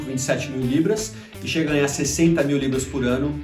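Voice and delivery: ordinary talk, nothing marked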